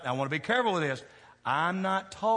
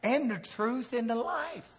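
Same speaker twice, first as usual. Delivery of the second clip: emphatic answer; 8 semitones higher, slower than usual. Retold or imitated. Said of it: "I said".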